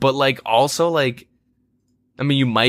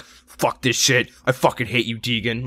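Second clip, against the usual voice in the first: mock angry teammate, slow. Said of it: "this person's like".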